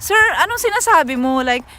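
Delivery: high, fast, frantic plea to the agent; Tagalog-English high frantic plea; high pleading exasperation. Retold or imitated. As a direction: beg him, voice up high and rushed. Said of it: "I said".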